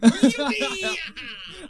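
high, gleeful burst of laughter; joyful whooping laugh. Acted. Boy laughs at finding his friend alive, gleeful.

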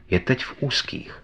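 plain reading voice

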